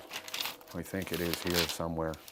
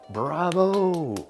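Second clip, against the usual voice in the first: shouted bravo, high and loud; mock cheer; 9 semitones higher, 9 dB louder than usual. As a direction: call out like a cheering crowd. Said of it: "the wind isn't gonna sit there and say".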